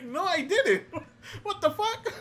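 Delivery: high retold denial through giggles; laughing mock protest. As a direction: deny it while breaking into laughter.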